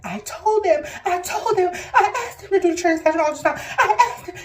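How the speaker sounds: high, loud tearful outburst, retold; acted distraught cry, phrases repeated in protest; re-enacted crying protest. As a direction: wail it as a patient who wasn't listened to. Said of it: "I started crying instantly".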